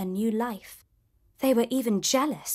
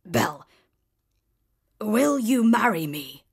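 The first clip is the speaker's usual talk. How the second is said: gruff beast. A narrator's reading. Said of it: "asked gruffly".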